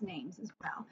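soft secret whisper; whispered aside. Real, not acted. Teacher ending in whisper.

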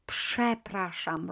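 przepraszam said slowly, starting psh; slow pronunciation. Shown as a demonstration. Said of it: "but we pronounce it".

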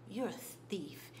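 a hushed, threatening whisper; hiss-free breathy accusation. Retold or imitated. Goon accusing her of stealing.

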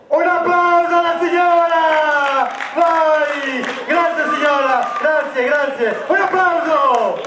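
rally the crowd to clap; calling for applause. Real, not acted. Magician asking the crowd to applaud the lady, excited call.

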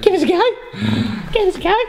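very high, raspy creature voice; Gollum voice. Retold or imitated. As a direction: croak it like a greedy cave creature. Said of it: "Who invited Gollum to the party?".

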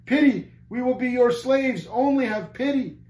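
high, loud begging; pleading cry. A narrator's reading. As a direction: cry out like a defeated captain. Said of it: "the captain himself cried out".

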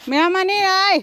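high, loud scolding call; calling the cats. Real, not acted. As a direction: break up the cat fight from afar. Calling cats to stop fighting.

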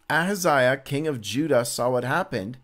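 normal talking voice

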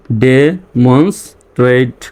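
very slow "demonstrate", gaps between pieces; teacher's slow sounding-out; syllable by syllable. Shown as a demonstration. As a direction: break "demonstrate" into de-mons-trate. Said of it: "reading পড়ে আসি".

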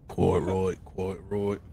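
clipped put-on British quite right; a lightly hammed British agreement; a hint of posh British; mock British accent. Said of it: "Do all the bloody work myself".

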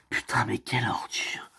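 hushed, disgusted whisper. Acted. Friend whispering disgust about the boyfriend, hushed whisper. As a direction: breathe it out under your breath.

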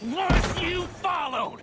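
high, slow shouted demand; shouted challenge. Acted. Micah demanding whether Arthur was followed, shouting. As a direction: bark it across the yard.

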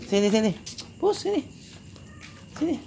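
high sing-song kitty call; high coaxing call of a cat feeder. Real, not acted. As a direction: lift the voice way up to coax the cat. Calling a cat over.

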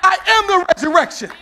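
high, shouted sermon climax; loud, driving preacher's refrain; full-force preaching. Real, not acted. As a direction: shout it over the congregation.

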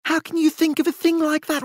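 high, rising agitation; overwrought, words tumbling; agitated Charles. A narrator's reading.

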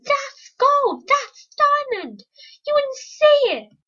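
squeaky bickering character. Acted. Voicing quarrel over diamond.

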